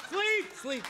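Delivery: high, loud repeated command; commanding shout. Real, not acted. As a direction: bark the word out. Hypnotist drops subjects.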